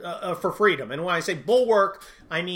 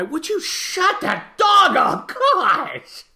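much higher, louder snap at the dogs; real exasperated yell; 13 semitones higher, 5 dB louder, much slower than usual. Real, not acted. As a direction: lose patience with the barking. Dogs interrupt the recording.

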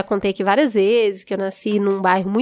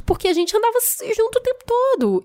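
ordinary delivery

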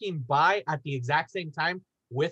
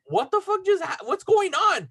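much higher, bewildered yelp of confusion; stunned what-is-happening cry; startled disbelief; 12 semitones higher, 4 dB louder than usual. Retold or imitated. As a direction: caught off guard mid-errand, voice jumps way up. Confused while holding shirts, guy charging.

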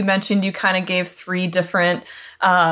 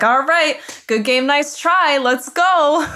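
mock gamer trash talk; 7 semitones higher, 3 dB louder, slower than usual.

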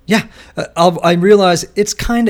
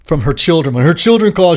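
ordinary delivery

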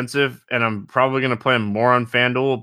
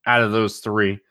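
ordinary talk, nothing marked

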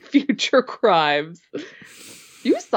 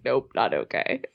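mock head-shaking refusal; 5 semitones lower, 4 dB quieter than usual. Teasing co-host mimics her friend's refusal, lower and softer. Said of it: "you're shaking your head like".

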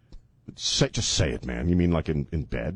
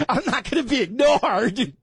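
shrill bossy woman; 13 semitones higher than usual. Acted. Acting the bossy fiancée.

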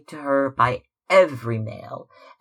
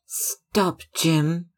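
sharp higher louder cry of stop; exclaiming; 7 semitones higher, 4 dB louder than usual. A narrator's reading. Sister stopping brother's insult, raised exclamation. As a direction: cut him off, raised and higher. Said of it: "she exclaimed".

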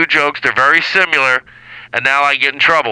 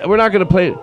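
normal talking voice

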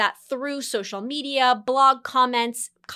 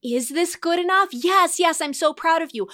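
higher, bright reassuring inner coach; a touch higher, the proud voice in your head; 5 semitones higher than usual. Pretend. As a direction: answer yourself as your own cheerful coach. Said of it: "I'm just the voice in your head".

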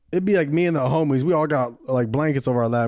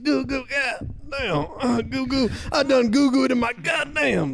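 put-on high, drawn-out baby voice; hammed-up baby talk with grown-up cursing; swearing baby; 8 semitones higher, slower than usual. Repeats the swearing baby bit.

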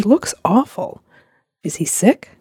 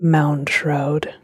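sepulchral; 5 semitones lower than usual. Acted. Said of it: "giving it a full sepulchral night church sound".

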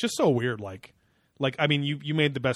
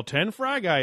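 retold yell; 7 semitones higher, 5 dB louder, slower than usual. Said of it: "we'd yell".

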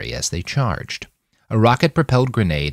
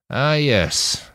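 higher-pitched sigh; 6 semitones higher than usual. A narrator's reading. Nanayazi recognizes the cavalry on the screens, sighing. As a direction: sigh the words out. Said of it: "Nanayazi sighed".

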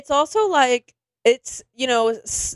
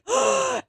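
mock gasp; 14 semitones higher, 3 dB quieter than usual. Everyone reacts to the accusation.

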